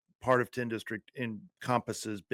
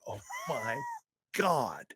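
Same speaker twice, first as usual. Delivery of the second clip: mock amazed gasp; 4 dB quieter, slower than usual. Retold shock at the ER.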